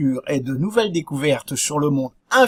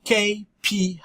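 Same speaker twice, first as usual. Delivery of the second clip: higher, showy English accent; 5 semitones higher than usual. Shown as a demonstration. Presenter showing off the English term to viewers, English accent. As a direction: put on an English accent for the acronym. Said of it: "Vous avez vu l'accent?".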